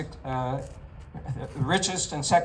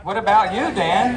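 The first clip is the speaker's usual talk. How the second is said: higher, loud demanding question; loud challenge; 6 semitones higher, 9 dB louder than usual. Retold or imitated. Town hall speaker loudly challenging the congressman on behalf of voters. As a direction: call out the question like the whole state is asking. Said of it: "all Pennsylvanians asked".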